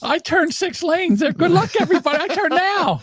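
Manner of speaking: high, thin mocking voice. Mocking a driver to the guys, in a high put-on voice.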